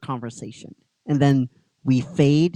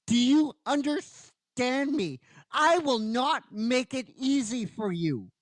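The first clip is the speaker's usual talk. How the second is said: higher, steady defiant promise; lightly voiced defiant girl; defiant heroine; 7 semitones higher than usual. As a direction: hold your ground. Kimberly defiant speech.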